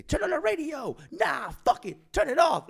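imitating a singer stopping the show; mock stage shout. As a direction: command the band to cut it. Quoting Zach stopping song.

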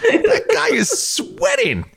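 high laughing, slow and drawn out; laughing squeal. Real, not acted. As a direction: laugh it out, pitch shooting up. Laughing mock onlooker.

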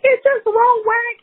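high, slow crying wail; a retold crying wail, high and drawn out; crying while talking. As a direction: sob the words out high and slow. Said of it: "And I'm like".

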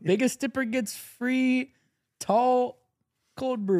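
high, slow hyped announcer. Pretend. Mock megaphone announcement.

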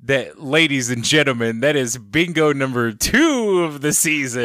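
playful big-event announcer voice; mock announcer. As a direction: proclaim it like a ringside announcer. Announces co-host's bingo win.

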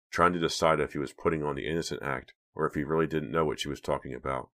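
plain reading voice

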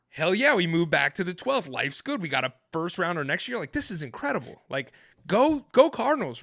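high, loud fan cheer; thrilled cheering. Retold or imitated. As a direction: celebrate like your team won. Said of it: "I'm like".

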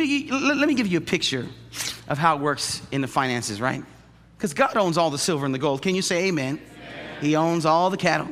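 usual speaking voice

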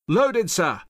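high, loud soldier's yell; yelled report. A narrator's reading. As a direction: yell the report up to your officer. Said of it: "Thompson yelled".

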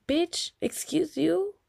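high, drawn-out indignant comeback; offended, sassy disbelief. Retold or imitated. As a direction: stretch it out, high and affronted. Indignant at brother over messed-up car.

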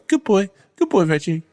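very high cooing praise; high puppy-talk praise for the dog; praising a puppy. Shown as a demonstration. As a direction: gush over the dog like a baby. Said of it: "stroke his head and go".

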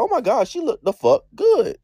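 high-pitched retold shock; shocked gushing. Telling friends about her first sight of the look, shocked. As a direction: gush it high, stunned by how good it is. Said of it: "I was, like, shocked".

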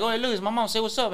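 high, excited fan pushing; eager fan. Retold or imitated. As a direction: sell it like a starstruck fan.

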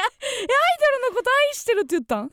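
fast, high and loud, disbelieving; baffled. Real, not acted. Co-host stunned by the lyric mix-up, baffled and fast. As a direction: cut in, can't believe what you heard. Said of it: "co-host baffled by the mistake".

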